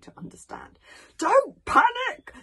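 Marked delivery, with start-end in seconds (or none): 1.2-2.3 high, slow, very loud shout; yelling. A narrator's reading. Old Wrinkly to the panicking hooligans, yelling. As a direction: yell each word over the panic. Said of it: "he started yelling".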